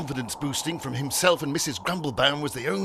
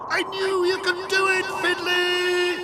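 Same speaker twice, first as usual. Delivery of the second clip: high, triumphant calling voice; jubilant cry; 14 semitones higher, 4 dB louder, slower than usual. A narrator's reading. Algy praises Fiddly as he grows, jubilant cry. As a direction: cheer the hero on, voice held high. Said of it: "cried Algy".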